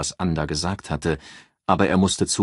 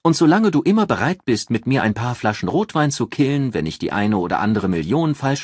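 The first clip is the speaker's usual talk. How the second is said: higher, louder assured female voice; raised voice for a calculating friend giving advice; Ann, cool strategist; 6 semitones higher, 5 dB louder than usual. Acted. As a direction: lay out the plan like a cool strategist. Ann teasing.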